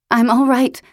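high, loud insisting; insistent reassurance. Acted. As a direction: insist loudly you're fine. Fallen woman reassures worried Ellis.

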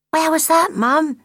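put-on high, loud childlike voice; young gosling. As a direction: call out like an eager little gosling. Gosling questions his mother.

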